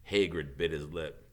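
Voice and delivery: ordinary delivery, nothing marked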